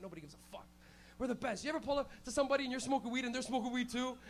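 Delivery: usual speaking voice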